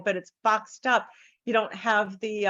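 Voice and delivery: usual speaking voice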